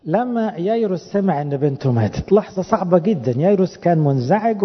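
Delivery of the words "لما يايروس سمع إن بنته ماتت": ordinary talk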